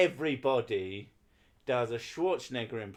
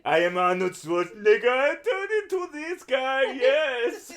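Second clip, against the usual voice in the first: put-on shouted, high Austrian accent, laughing; a hammed-up Austrian strongman shout; bad Arnold Schwarzenegger accent; 12 semitones higher, 10 dB louder than usual. Streamer doing a bad Austrian Arnold for chat, shouting. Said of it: "my shit Arnold Schwarzenegger accent".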